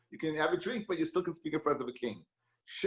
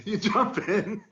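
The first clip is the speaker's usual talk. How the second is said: laughing; 4 semitones higher, 3 dB louder, slower than usual. Real, not acted. Laughs while telling the story.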